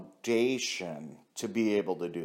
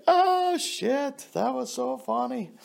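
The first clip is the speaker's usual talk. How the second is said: high laugh breaking into a whoop; laughing hard; 11 semitones higher than usual. Real, not acted. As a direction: crack up at your own story. Cracking up at the story.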